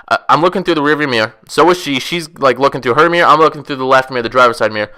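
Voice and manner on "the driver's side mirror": normal talking voice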